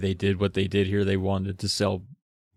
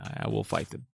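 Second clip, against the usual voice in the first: mock-serious whisper; whispered vow; 8 dB quieter than usual. Vowing to fight tooth thieves, mock whisper. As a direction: hush it like a secret threat.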